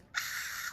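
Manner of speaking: voiceless breathy mock scream; hushed imitation of screaming fans; imitated crowd scream. Tweens reacting to the singer's joke, breathy mock screaming. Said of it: "And all the tweens".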